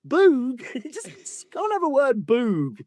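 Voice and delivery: high-pitched, laughing disbelief; incredulous. Real, not acted. Reader mocking another Dutch surname, incredulous and laughing.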